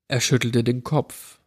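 ordinary delivery, nothing marked

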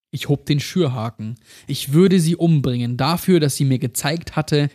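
ordinary delivery, nothing marked

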